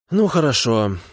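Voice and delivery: sigh-like concession, full of regret; deepest regret. A narrator's reading. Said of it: "с выражением глубочайшего сожаления".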